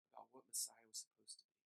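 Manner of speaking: usual speaking voice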